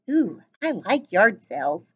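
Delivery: ordinary delivery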